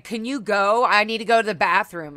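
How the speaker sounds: retold angry plea to go; upset and mad. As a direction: push her out, hurt and angry. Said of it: "And I was just so mad".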